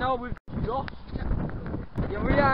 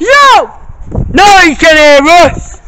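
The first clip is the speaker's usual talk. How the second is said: loud, high yell over the wind; shouting over wind; 7 semitones higher, 17 dB louder than usual. Real, not acted. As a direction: shout to be heard outdoors.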